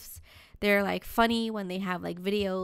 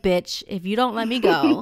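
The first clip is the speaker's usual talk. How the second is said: loud, lightly voiced grumpy cat; annoyed cat voice; 7 dB louder than usual. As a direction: threaten like a fed-up cat.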